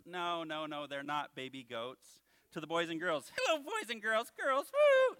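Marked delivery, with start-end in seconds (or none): 3.4-4.3 high puppet greeting; squeaky little puppet saying hello; Bones. 4.4-5.2 very high, loud puppet whoop; flirting puppet.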